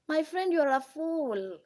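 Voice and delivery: normal talking voice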